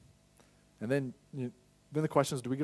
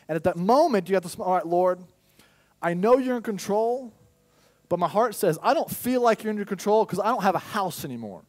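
anguished loud prayer; 8 semitones higher, 8 dB louder than usual. Retold or imitated. Pastor replaying his prayer after losing the house, loud and pleading. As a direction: cry out to the Lord, half protesting. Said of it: "and I was like".

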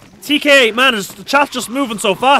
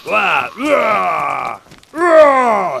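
mocking wah wah shout; 3 semitones lower, much slower than usual. Real, not acted. Taunting zombies while fighting.

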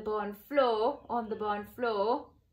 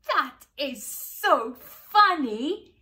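slow laughing voice; 4 semitones higher, 10 dB louder, slower than usual. A narrator's reading. Animal laughing at cow.

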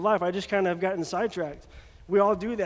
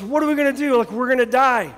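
higher, louder frightened cry; panicked servant; 5 semitones higher, 6 dB louder than usual. Retold or imitated. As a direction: blurt it like you've seen a ghost. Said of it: "like he's seen a ghost".

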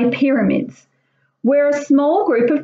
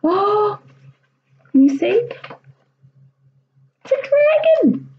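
high, thrilled reveal; excited; 7 semitones higher than usual. Real, not acted. Reader's excited aside to kids.

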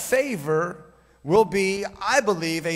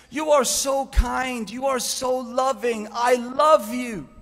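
higher, slow, gushing lady's voice; gushing airline lady; 7 semitones higher, 4 dB louder, slower than usual. Retold or imitated. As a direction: gush it out like a smitten clerk. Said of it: "And she says".

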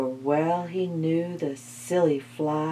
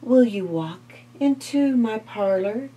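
lightly raised coaxing spider voice; sly spider; 6 semitones higher, 5 dB louder than usual. Acted. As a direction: invite her in, sweet and sly. Spider invites the fly.

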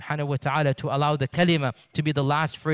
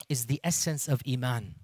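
ordinary talk

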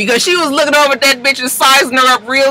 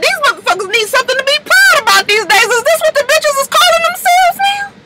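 very high, disbelieving exclaim; incredulous; 14 semitones higher than usual. Real, not acted. Ranting about reality stars, high incredulous. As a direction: ask it in utter disbelief.

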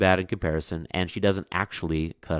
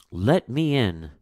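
slow pleading call; 5 semitones higher, 5 dB louder, slower than usual. Acted. Mother calling, third time.

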